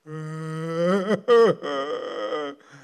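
long hammed-up whimpering whine; mock whining wail. Spiritual whiner without the Holy Spirit, mock wail. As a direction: whine wordlessly, loud and drawn-out. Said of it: "I become a spiritual whiner".

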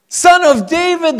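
high, slow, loud plea; crying out. A narrator's reading. Blind men crying out to Jesus for mercy, loud high plea. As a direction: call out to him for mercy. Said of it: "they cry out".

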